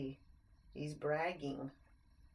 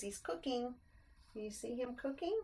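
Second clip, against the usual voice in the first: usual speaking voice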